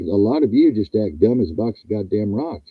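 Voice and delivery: ordinary talk, nothing marked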